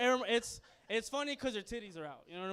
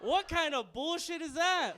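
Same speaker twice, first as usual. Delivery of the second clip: slow, high comic shout; loud, drawn-out disbelief; outraged yell; 8 semitones higher, 9 dB louder, slower than usual. Acted. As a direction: holler in mock outrage. Comic outraged at mother's choking.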